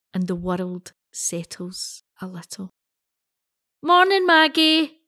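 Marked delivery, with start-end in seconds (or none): 3.8-5.0 bright, high, loud hello; enthusiastic greeting. Acted. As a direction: call out a cheery morning greeting. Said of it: "greets me with her now usual enthusiasm".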